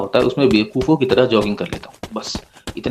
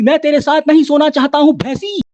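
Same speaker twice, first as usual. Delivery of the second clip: high, strained mock yell; exasperated outburst, pitched far up; imagined shout; 13 semitones higher than usual. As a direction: pretend to scream it from a tabletop. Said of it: "जोर से table के ऊपर खड़े होके चिल्लाऊं".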